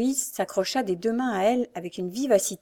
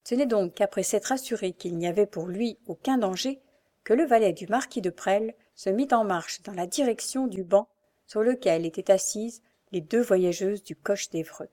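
ordinary delivery

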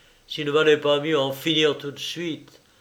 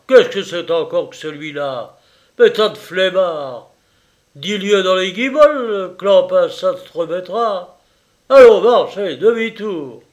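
loud, high contemptuous yelling; bellowing; 5 semitones higher, 10 dB louder than usual. Acted. Assistant surgeon driving the sick soldier off, bellowing. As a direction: bark orders to send him away. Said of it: "Il vociféra".

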